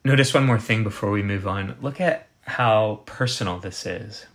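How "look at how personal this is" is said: ordinary talk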